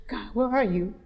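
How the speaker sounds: reenacted tearful plea to God; retold tearful prayer; crying plea. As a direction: gasp in, then plead softly as if crying. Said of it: "I'm crying".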